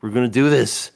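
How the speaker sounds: loud, emphatic mock exasperation; exasperated confirmation. Acting out the answer to a fifth asking, exasperated confirmation. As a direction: answer firmly, fed up with being asked.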